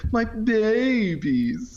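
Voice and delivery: high, very slow, stretched "My babies"; high drawn-out babies voice. Retold or imitated. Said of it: "it's something like".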